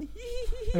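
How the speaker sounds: high giggling little princess; kleine Prinzessin. Pretend. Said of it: "lachst du noch so wie eine kleine Prinzessin". (Kichern wie eine kleine Prinzessin)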